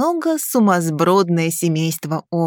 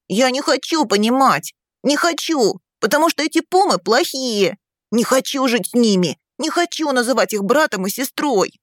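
put-on high, heated little-boy outburst; protesting child; 6 semitones higher than usual. A narrator's reading. Young son rebuking father over new family, heated protest. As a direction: refuse insistently like an upset small child. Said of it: "Часто-часто дыша, выговаривал юный наследник".

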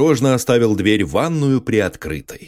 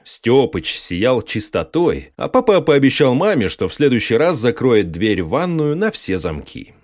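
usual speaking voice

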